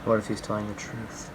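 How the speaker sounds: very soft, low mumble to oneself; low muttered self-talk. Shown as a demonstration. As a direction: mumble it under your breath, barely audible. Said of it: "You can have a mumble like".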